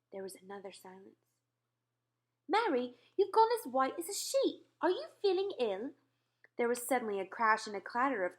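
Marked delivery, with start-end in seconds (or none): 2.5-5.9 raised, shaken royal voice; a queen still upset by a nightmare; queen's voice. Acted. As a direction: tell the dream as a rattled queen. Queen alarmed at pale maid.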